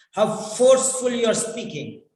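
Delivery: ordinary delivery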